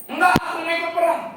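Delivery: put-on high, fast childish protest; protesting boy. Boy refusing to go home, high fast protest. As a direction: whine back like a stubborn kid.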